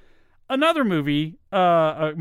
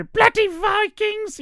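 very high, drawn-out British screech; Monty Python old lady; 4 dB louder, slower than usual. Acted. Quoting a British comedy sketch, slow high screech. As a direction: screech it slowly in comic falsetto.

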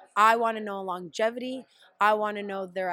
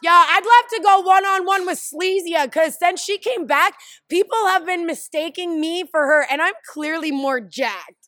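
excited; 6 semitones higher, 8 dB louder than usual. Real, not acted. Joking about a rival lookalike.

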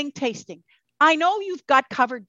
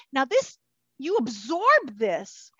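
normal talking voice

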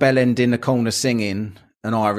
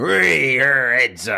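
pirate cry; 5 semitones higher, much slower than usual. Retold or imitated. Mimicking English pirate cry.

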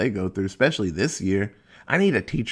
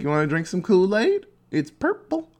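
sweet, much higher luring voice, rising up on purple; creepy cult recruiter; 8 semitones higher than usual. Retold or imitated. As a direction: lure him in with a sugary high pitch. Cult members offering Kool-Aid.

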